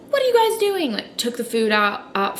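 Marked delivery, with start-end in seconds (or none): 0.1-0.9 high sharp mock mom; imitating mom. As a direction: voice the mother catching them, high. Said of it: "my mom was like".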